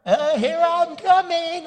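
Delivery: put-on high, loud, slow old-man groan; granddaughter mimicking grandpa. Granddaughter mimicking grandpa on his walker, high slow groaning. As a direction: creak it out like a frail old man. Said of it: "Or she'd get the walker".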